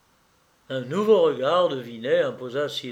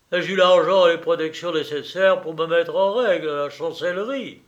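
loud, higher-pitched military man; declaiming colonel; 5 semitones higher, 7 dB louder than usual. Acted. Colonel explaining his lost title to Sylvie, loud declaiming. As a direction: proclaim your grievance in a raised voice.

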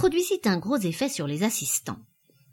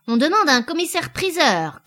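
raised, louder public call; 8 semitones higher, 6 dB louder than usual. A narrator's reading. Hay-trusser calling for an auctioneer, shouting. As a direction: shout it to the crowd, pitched high. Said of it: "cria le botteleur".

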